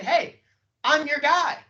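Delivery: high, loud, excited call; eager prospect. Retold or imitated. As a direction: call out eagerly, pitch jumping up. Acting out an eager prospect's words.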